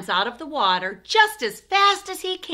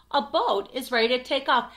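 ordinary delivery, nothing marked

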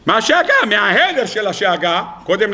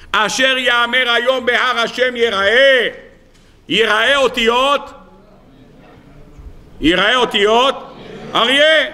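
slow, raised-pitch declamation; declaimed text reading; 4 semitones higher, slower than usual. Acted. Teacher citing verse and commentary to his class, slow declamation. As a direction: draw out each phrase of the sacred text.

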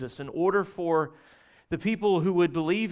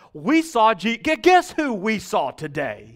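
higher and louder, tripping over the words in excitement; excited disciples; 5 semitones higher, 7 dB louder, slower than usual. Retold or imitated. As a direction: blurt it out like breaking big news. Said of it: "they're all like".

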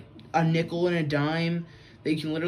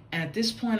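ordinary delivery

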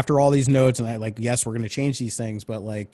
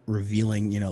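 usual speaking voice

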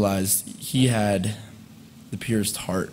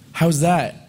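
cocky challenge; 8 semitones higher, 5 dB louder than usual. Retold or imitated. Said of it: "he'd look back at you".

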